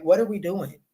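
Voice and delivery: usual speaking voice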